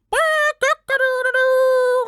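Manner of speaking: very high, loud, slow crow; a loud rooster's crow, stretched out. Acted. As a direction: crow at full volume like a rooster. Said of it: "cried out his loudest".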